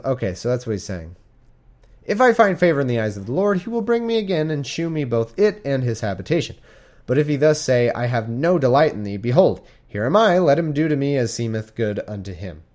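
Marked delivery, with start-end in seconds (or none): 2.0-12.6 higher, louder solemn Bible reading; scripture reading. Acted. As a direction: read it like the pulpit. Reading King James scripture aloud.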